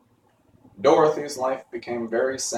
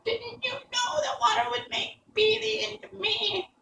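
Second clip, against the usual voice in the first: wailing, desperate witch. A narrator's reading. Witch asks Dorothy about the water, desperate wail. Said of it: "in a wailing, desperate voice".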